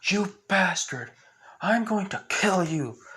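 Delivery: loud, high-pitched furious threat; yelling. Acted. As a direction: yell it at a hated enemy, drawing out the words. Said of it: "And yells at the Bli".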